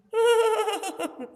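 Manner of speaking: very high whinnying neigh; whinny high like a horse; horse neigh imitation. Shown as a demonstration. Teacher whinnies like a horse to show the children. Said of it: "Like this, horse is making sound".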